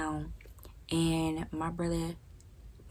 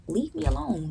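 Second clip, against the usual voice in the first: exasperated; 9 semitones higher than usual. Retold or imitated. Rant at brother.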